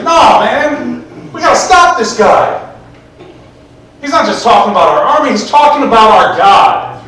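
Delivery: rally the others in indignation; indignant rallying. Retold or imitated. David rallies against Goliath.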